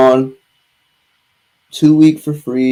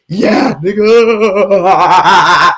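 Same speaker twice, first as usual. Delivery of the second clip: laughing; 5 semitones higher, 5 dB louder, much slower than usual. Real, not acted. Exclaiming with laughter.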